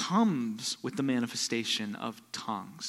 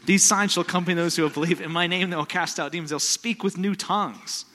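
loud, high, fast proclamation; preacher declaring scripture with fire; proclaimed scripture; 7 semitones higher, 8 dB louder than usual. Acted. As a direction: thunder the verse out.

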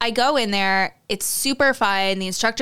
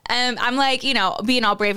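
ordinary talk, nothing marked

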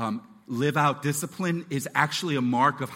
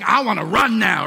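put-on restless toddler; 3 semitones higher, 9 dB louder than usual. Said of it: "And then they're like".

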